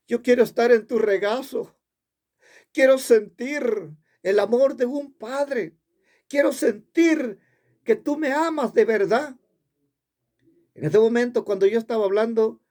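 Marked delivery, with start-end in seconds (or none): 0.1-9.4 high, insistent pleading in retold prayer; heartfelt plea. Man retells begging for a Father's love, high pleading. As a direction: plead upward in separate, emphatic phrases.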